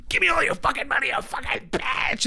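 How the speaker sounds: high-pitched strained yell, dragging out the last words; over-the-top shout. Retold or imitated. Suspect acting out his line in the police lineup, wild high shout. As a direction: yell it like a hammed-up stick-up.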